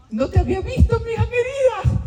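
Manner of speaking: woman greeting a dear friend, high and gushing; sweet greeting. Retold or imitated. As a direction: coo it like a delighted old friend.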